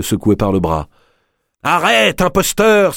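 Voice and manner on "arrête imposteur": much higher, sharp accusing cry. A narrator's reading. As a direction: denounce him as an impostor. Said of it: "s'écria Madame Olcott".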